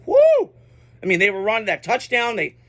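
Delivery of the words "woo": excited woo. Retold or imitated. Cheering a remembered Clemson upset.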